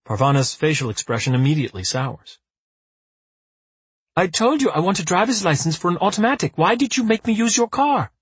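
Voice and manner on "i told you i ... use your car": put-on annoyed young woman. A narrator's reading. Parvana complains, pitched up.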